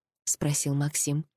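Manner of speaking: ordinary delivery, nothing marked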